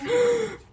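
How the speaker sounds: high sharp gasp of delight. Real, not acted. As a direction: gasp at the smell. Smells the dough.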